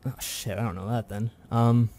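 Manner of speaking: normal talking voice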